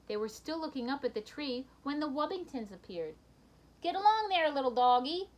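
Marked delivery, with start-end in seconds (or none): 3.8-5.3 high, loud folksy cowhand call; Bernie's cowboy call. Acted. Husband rounding up his bulldog, cowboy call. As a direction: herd the dog like a cowboy. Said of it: "Bernie said".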